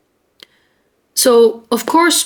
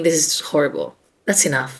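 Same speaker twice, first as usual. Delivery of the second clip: normal talking voice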